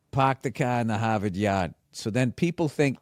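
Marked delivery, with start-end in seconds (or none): 0.1-1.7 Boston accent, dropped r's. Shown as a demonstration. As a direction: pahk the cah, Boston style. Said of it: "there's the joke".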